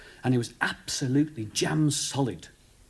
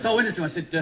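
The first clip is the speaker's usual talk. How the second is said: ordinary delivery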